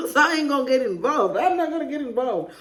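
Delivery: chuckling through the words; laughing it off. Real, not acted. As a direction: laugh it away, brushing the topic aside. Laughing off a touchy topic.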